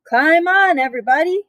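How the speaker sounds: high, loud call to everyone; shouted invitation. Acted. Duck calling the animals aboard, loud and high. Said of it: "shouted Duck".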